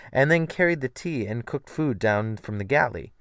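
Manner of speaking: plain reading voice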